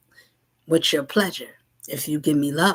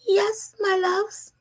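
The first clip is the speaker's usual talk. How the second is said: much higher and slow, an otherworldly guide; mystic spirit voice; much slower than usual. Acted. Spirit answers the seekers, high and slow. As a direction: answer like a serene spirit from far away. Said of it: "Why you talk like that?".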